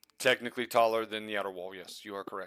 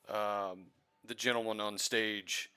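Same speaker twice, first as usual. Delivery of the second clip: ordinary talk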